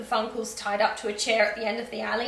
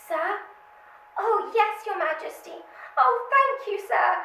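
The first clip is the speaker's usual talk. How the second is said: higher, breathless gushing to royalty; playing Claire overwhelmed, higher and louder; gushing on the phone; 10 semitones higher, 3 dB louder than usual.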